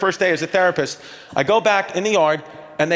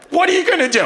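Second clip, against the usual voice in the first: frantic wife; 11 semitones higher, faster than usual. Retold or imitated. Panicked wife demanding what the therapist will do. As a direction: squeal the question in mock panic. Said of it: "And she goes".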